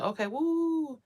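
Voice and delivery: high, delighted mock bliss; a lifted, happy okay woo. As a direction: sing out the woo in relief. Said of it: "I'm like".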